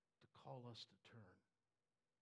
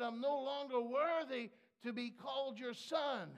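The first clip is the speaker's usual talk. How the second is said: loud high self-abasing plea, much higher; groveling plea; 9 semitones higher, 13 dB louder than usual. Acted. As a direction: beg like you deserve nothing.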